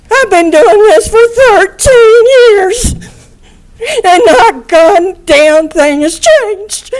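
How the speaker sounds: pushing the words out through real sobs; tearful, strained and slow; crying. Real, not acted. Grieving mother addressing court, crying.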